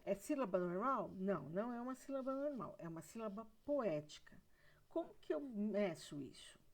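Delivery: ordinary talk, nothing marked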